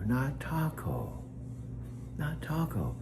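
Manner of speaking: Not taco slow and firm, taco falling; stress on taco. Shown as a demonstration. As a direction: correct her, stressing taco. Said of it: "I'm Alexa's voice coach".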